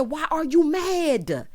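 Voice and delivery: high, drawn-out challenge; mock indignant demand. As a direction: imitate an exasperated lover, stretching it out. Retold lovers' quarrel.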